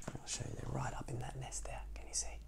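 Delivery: soft whispered aside. Real, not acted. Pointing out the nest to the child, whispered. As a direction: lean in and whisper to the little listener.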